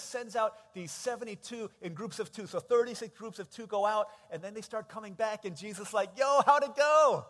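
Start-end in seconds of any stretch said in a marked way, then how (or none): 6.2-7.2 high, loud, drawn-out greeting; eager call. Acted. Jesus greeting his returning disciples, eager loud call. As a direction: call out eagerly to friends coming back.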